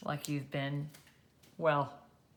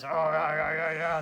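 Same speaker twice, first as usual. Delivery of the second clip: a hint of a dopey monster saying yeah; mild monster mumble; Frankenstein's monster. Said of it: "That's how he talks".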